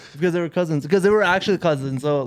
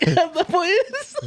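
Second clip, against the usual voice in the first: much higher, laughing through the words; laughing retort; 13 semitones higher than usual. Retold or imitated. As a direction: crack up while delivering the joke.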